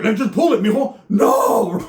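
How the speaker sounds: high, loud coaxing then a mock cry; uncle with a touch of crying kid. Uncle pulling dislocated arm, high coaxing and loud wail. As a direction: coax gently then wail no.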